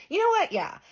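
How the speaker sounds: agreeing with friends about the eye; sudden delight. Retold or imitated. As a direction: snap into glee. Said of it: "I'm like".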